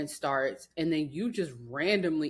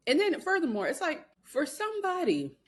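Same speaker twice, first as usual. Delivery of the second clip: excited rant; 7 semitones higher than usual. Real, not acted. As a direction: pitch jumps much higher, rising indignation. Heated rant about the rival.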